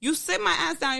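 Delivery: usual speaking voice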